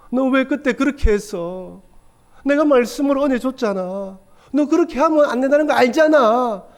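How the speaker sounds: a hint of a disappointed father scolding; reproachful God.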